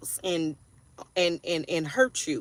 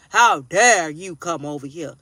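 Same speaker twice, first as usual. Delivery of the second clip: How dare you come over here? said louder, stress on How; indignantly telling the drunk friend off, louder second time; louder indignant repeat; 2 semitones lower, 8 dB louder than usual. Retold or imitated. To the friend at her door, a louder outraged repeat.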